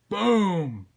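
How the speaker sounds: big, high, drawn-out boom; loud exploding sound effect. Shown as a demonstration. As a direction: belt it out like an explosion. Same word repeated as an explosion.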